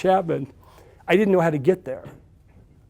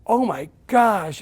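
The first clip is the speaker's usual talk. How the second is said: stunned wonder; 5 semitones higher, much slower than usual. Retold or imitated. Receiving the big check.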